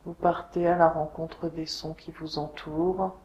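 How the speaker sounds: guided meditation. Acted. Yoga teacher guiding listeners lying on the floor, meditation voice. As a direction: guide them unhurriedly into deep rest, letting phrases settle. Leads a Yoga Nidra relaxation.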